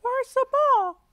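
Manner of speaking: put-on high little girl apology; Peppa Pig voice. A narrator's reading. Peppa apologises to little brother George, high voice. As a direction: say sorry sweetly. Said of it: "asks Peppa".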